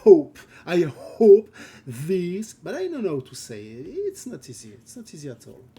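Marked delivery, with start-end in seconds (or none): 0.0-0.4 a very loud, forced breathy h on hope; aspirated h. Shown as a demonstration. Learner showing viewers she must aspirate the h. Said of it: "I have to". 0.7-2.5 hope said slowly with a deliberate h; slow down and stress the h in hope; careful aspirated h. Shown as a demonstration. Learner practising the English h for her Twitch chat. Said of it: "I have to".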